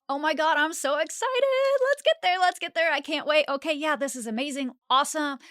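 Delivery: high, loud, thrilled gush; excited. Acted. Voicing intuition on the skydiving drive, thrilled and loud. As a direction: squeal it like you can't wait to jump. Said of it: "your intuition feels a little bit like this".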